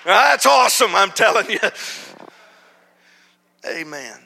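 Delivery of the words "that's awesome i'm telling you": loud, high praise; excited exclamation. Real, not acted. Preacher celebrating the story with his church, loud and high. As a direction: throw it out to the church.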